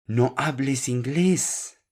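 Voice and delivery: high, slow scolding yell; professor's yell. Acted. Professor tells student off for speaking English, high-pitched yell. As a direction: raise the pitch and draw it out, reprimanding. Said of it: "El profesor le grita".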